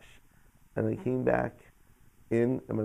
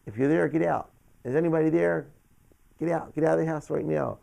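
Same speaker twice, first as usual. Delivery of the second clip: higher, louder, mock yell at an intruder; retold yelling; 6 semitones higher, 5 dB louder than usual. Son yells at an intruder downstairs, retold yell. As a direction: call out raised and firm, a retold shout. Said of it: "and I'm yelling".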